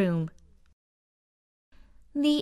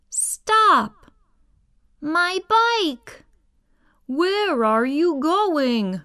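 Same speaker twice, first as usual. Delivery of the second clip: put-on kid shouting; 7 semitones higher, slower than usual. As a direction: chase them, yelling for your bike. Kid chasing the aliens.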